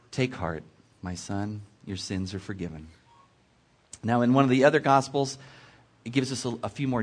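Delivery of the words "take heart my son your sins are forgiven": low, soft words of comfort; gentle reassurance. A narrator's reading. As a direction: lower the voice and reassure softly. Jesus comforting the paralytic, read aloud.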